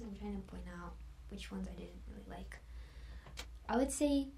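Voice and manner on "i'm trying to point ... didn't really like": whisper. Real, not acted. Confessing dislike under her breath.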